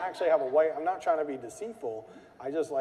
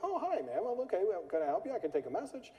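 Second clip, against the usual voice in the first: higher, calm and polite, lightly voiced; super calm receptionist; 7 semitones higher, 4 dB quieter than usual. Receptionist calmly answering an irate caller, mock calm. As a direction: soothe the angry caller sweetly. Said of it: "And she's, like, super calm".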